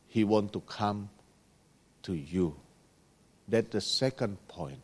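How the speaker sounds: low, hushed reflection with long pauses; soft heartfelt preaching. Real, not acted. Preacher confessing "I am the sinner" to brothers and sisters, soft and low. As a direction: bring it right down, humbly confiding.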